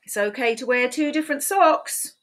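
bright, high storybook read; sing-song read-aloud for little kids; reading to children. A narrator's reading. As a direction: read it like a cheerful picture book. Reading picture book aloud.

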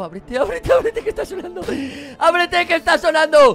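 high urgent begging; desperate pleading. Real, not acted. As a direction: beg the door frantically. Door must open, alarm sounding.

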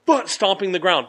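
usual speaking voice

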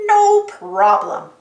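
high, loud, slow cartoon voice; drawn-out, obliging character voice; confident map character. A narrator's reading. Map answers Dora's plea for help, confidently. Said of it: "said Map".

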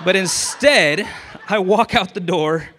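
chuckling it out, amused at himself; laughing. Real, not acted. Salesman laughing at how the bad day ended, loud chuckles. Laughing at his own story.

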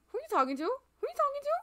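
high, loud, squeaky demand; playful pestering. Pretend. Player nosily asking Fang who he talks to, squeaky pestering. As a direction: repeat it climbing into a squeal.

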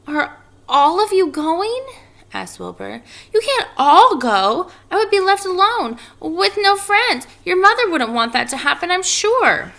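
put-on high, loud, pleading young pig; plead, close to panic; upset pig voice. Wilbur pleads with spiders.

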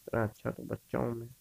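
ordinary delivery

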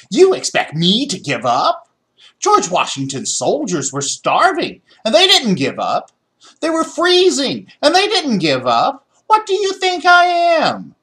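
high, loud indignant rant; furious rant. A narrator's reading. Boy rants angrily at his family, high and loud. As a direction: build a furious tirade. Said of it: "George was so mad".

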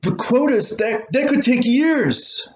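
put-on high, slow, disappointed child's voice; a kid deflating at the long wait; dismayed boy. Boy dismayed by his mother's news.

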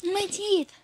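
high, loud complaint; pained cry. Real, not acted. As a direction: yelp about her teeth. Pained outburst.

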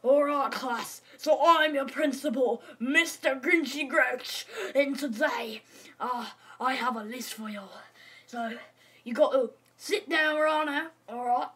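put-on bossy Australian accent; Australian principal. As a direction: lay down rules with an Australian twang. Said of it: "but I'm Australian".

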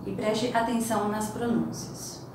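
normal talking voice

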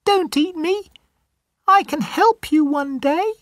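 very high, tiny mouse voice; squeaky mouse. Acted. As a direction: squeak it like a scared little mouse. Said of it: "says the mouse".

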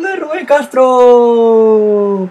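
long drawn-out high yell of GOL; goal shout. Real, not acted. Gamer celebrating his goal for viewers, drawn-out yell. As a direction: stretch every word, scream the goal like a commentator. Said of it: "Como siempre yo, gritando poco".

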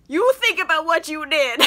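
high, hammed-up telling-off; mock scolding. Dorian lecturing James before leaving, mock scolding. Acted parting scolding, then laughs.

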